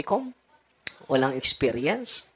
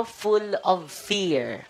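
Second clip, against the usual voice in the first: Full of fear stretched out very slowly, each word drawn long; very slow emphatic repeat; 6 semitones higher, much slower than usual. Shown as a demonstration. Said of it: "Ano yung mga demonyo?".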